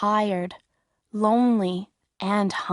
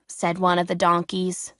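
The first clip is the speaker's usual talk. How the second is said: plain reading voice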